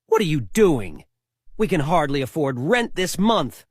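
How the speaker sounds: ordinary talk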